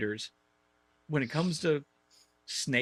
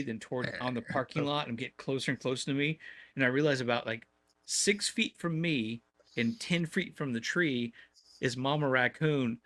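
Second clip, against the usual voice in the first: normal talking voice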